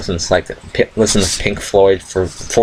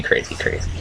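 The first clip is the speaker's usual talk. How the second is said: whispered, softer, trailing off; fading whisper; 7 dB quieter than usual. Real, not acted. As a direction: let it die away to a whisper.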